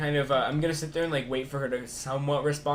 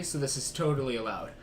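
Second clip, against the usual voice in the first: ordinary talk, nothing marked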